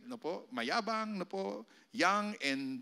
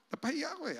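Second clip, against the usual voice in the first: ordinary delivery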